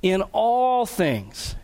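"all" drawn out long and slow; emphatic ALL. Shown as a demonstration. Preacher echoing Paul's emphatic point, slow stress on "all". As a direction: stretch the word "all" for emphasis. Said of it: "he's been emphatic throughout this".

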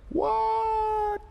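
high squeaky startled What; shocked "What?". Retold or imitated. Crowd stunned at Jesus' choice, squeaking in shock. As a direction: yelp it in disbelief. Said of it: "Probably that exact tone".

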